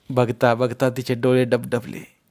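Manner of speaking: ordinary delivery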